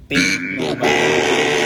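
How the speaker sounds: very low, slow robot voice; deep slowed voice effect. Shown as a demonstration. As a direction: drag it out deep and slow through the mic. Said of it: "Ito yung good news".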